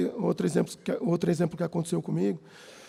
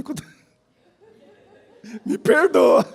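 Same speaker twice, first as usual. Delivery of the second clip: laughing; 7 semitones higher, 6 dB louder than usual. Real, not acted. Preacher laughs at his own story.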